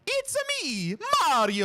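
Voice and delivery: very high falsetto, bombastic game catchphrase; Mario. Shown as a demonstration. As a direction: squeal it high like the video game plumber. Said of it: "listen to this guy do it".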